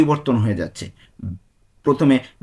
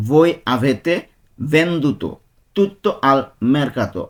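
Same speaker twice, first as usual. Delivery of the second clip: slow, careful Italian, word by word; slow demonstration; much slower than usual. Shown as a demonstration. Teacher to Bengali students learning Italian, slow and careful. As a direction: spell it out slowly for learners. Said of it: "teacher repeating an Italian sentence".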